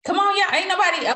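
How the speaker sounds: high, loud rallying call; urging call. Real, not acted. Host urging silent call group.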